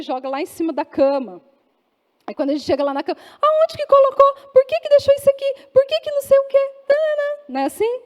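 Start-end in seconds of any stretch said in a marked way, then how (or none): 3.4-7.4 high-pitched, loud mock scolding; nagging wife. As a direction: nag in an exaggerated, piercing high voice. Wife nagging husband in story. (esposa reclamando, voz aguda e exagerada)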